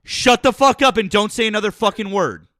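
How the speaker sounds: loud furious order; angry yelling. Real, not acted. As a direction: yell the threat at him. Heated argument, yelling at caller.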